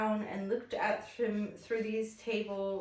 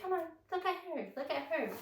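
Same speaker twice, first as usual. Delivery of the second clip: quick high coaxing voice; a mom quickly coaxing her kid to look, pitched up; imitating the mom; 8 semitones higher, 5 dB quieter, much faster than usual. Mom urging her son to watch, higher and quick. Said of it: "And she's like".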